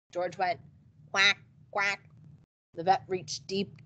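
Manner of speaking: normal talking voice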